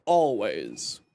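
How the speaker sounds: deep voice. Acted. Deep reply to Echo.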